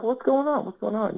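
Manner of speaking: high-pitched bewildered question; feigned innocence. Retold or imitated. Player answering an angry man standing up, high feigned innocence. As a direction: ask it high, as if clueless.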